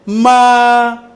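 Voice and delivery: "Ma" held long, high and level; first tone. Shown as a demonstration. Teacher has class repeat the first tone, high level "Ma". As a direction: sustain "Ma" loudly on one high pitch. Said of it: "la réponse".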